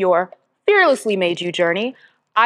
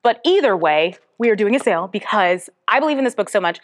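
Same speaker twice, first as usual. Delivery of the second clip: usual speaking voice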